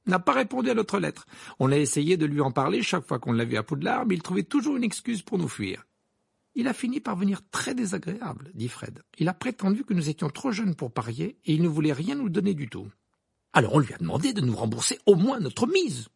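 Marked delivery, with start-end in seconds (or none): 0.1-8.5 lightly voiced indignant twin, higher pitch; a touch of animated grievance; aggrieved twin. Twin complaining to friends about an unpaid bet, lightly voiced indignation. 9.2-16.1 lightly voiced indignant twin, higher pitch; a touch of animated grievance; aggrieved twin. Twin complaining to friends about an unpaid bet, lightly voiced indignation.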